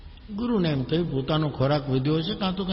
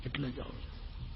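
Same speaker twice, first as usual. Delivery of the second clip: much softer, trailing off; faded quiet repeat; 2 semitones lower, 16 dB quieter than usual. Acted. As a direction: let the repeat fall almost to nothing. Ram repeats, fading away.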